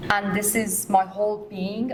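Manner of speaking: ordinary talk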